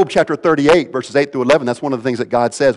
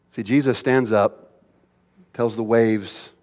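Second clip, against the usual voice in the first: ordinary talk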